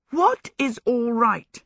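high, slow, emphatic question; exasperated demand. A narrator's reading. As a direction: demand an answer, word by word. Giddy Sophie demands an explanation.

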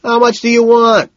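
high, loud question; pressing holler; desperate shout. A narrator's reading. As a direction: yell it urgently over the noise. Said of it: "looking desperate".

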